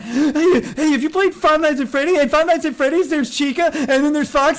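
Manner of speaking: put-on high, fast, breathless kid gushing; excited child. Mocking the streamer as an excited child. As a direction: gush like an overexcited kid who can't finish a thought. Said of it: "like listening to a child who's really into a thing".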